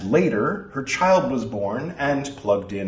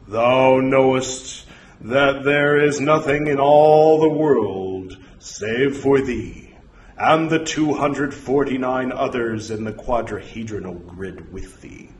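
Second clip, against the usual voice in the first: portentous voice from beyond; 3 semitones higher, 4 dB louder than usual. Acted. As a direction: intone it like a false oracle.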